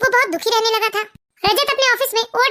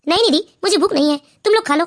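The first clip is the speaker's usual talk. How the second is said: much lower, tired man's voice; weary husband; 5 semitones lower than usual. Acted. Husband tells his wife he is not hungry, low and weary. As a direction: push the food away, worn down.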